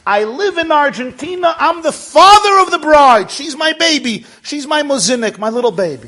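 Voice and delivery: put-on high, loud, exuberant old man; excited father of the bride; proud father. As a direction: announce it at full voice, bursting with pride. Proud father introduces himself.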